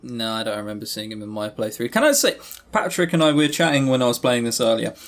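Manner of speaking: ordinary talk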